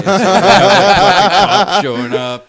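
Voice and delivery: words squeezed out through big laughter; laughing. Real, not acted. Laughing while telling friends about the cops.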